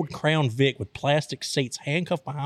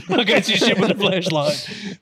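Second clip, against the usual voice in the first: laughing protest; 4 semitones higher, 4 dB louder than usual. Retold or imitated. Blinded by cop's flashlight, protesting.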